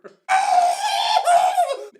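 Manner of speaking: long, very loud mock shriek; imitating a mother's horrified shriek; high piercing scream. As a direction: scream at full volume in sudden terror. Mom screams on seeing the masked child.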